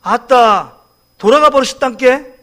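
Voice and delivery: exclaiming in Jeolla dialect. Acted. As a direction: imitate a Jeolla speaker breaking news of a death. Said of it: "전라도 사람은 어떻게 얘기해요". (전라도 사투리 흉내)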